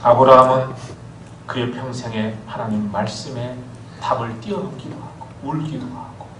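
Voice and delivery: ordinary delivery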